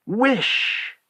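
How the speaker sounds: high, drawn-out insistent order; insistent command. A narrator's reading. Wife repeats her order to husband, insistent command. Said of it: "repeated his wife".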